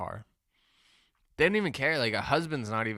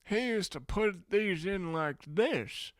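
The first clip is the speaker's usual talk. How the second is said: put-on high, slow storytelling old man; old man reminiscing; 8 semitones higher, slower than usual. Old man telling me about his father, slow high-pitched reminiscing. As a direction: stretch it out like an elder telling a long story. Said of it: "they wanna talk about their parents".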